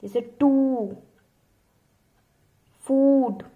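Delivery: teacher sounding out the long oo for steno students; long oo demo. Shown as a demonstration. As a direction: stretch the oo in टू and फूड. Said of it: "कैसे?".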